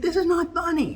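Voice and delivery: high, loud mock Kamala snapping; indignant scolding. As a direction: scold him sharply in a squeaky voice.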